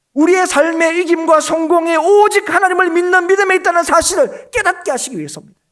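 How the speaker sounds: loud, high, sustained pulpit proclamation; emphatic preaching. Real, not acted. Preacher telling the congregation where victory lies, forceful proclamation. Preacher drives point home.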